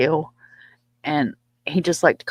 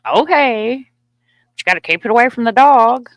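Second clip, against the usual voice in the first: high, loud mock concession; exaggerated retold agreement; imitated dad giving in; 7 semitones higher, 8 dB louder than usual. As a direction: play a husband caving in, pitched up and loud. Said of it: "And he goes".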